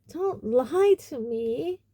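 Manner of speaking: high, slow wailing accusation; tearful gasp. Acted. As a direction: wail it through tears. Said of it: "Myrtle gasped, tears now flooding".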